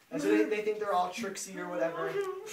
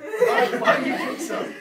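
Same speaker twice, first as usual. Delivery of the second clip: high, slow, laughing through the words; laughing offer; 10 dB louder, slower than usual. Retold or imitated. Greeks urging the Cyclops to drink, laughing. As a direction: giggle while pushing the drink.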